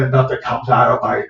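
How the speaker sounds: ordinary delivery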